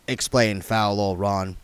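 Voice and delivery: ordinary delivery, nothing marked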